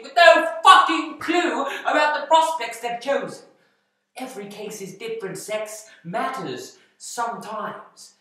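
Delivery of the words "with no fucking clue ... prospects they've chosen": raised pitch, loud exasperation; loud, high-pitched scolding; angry rant. Acted. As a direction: tear into useless staff. Boss berating staff as dimwits.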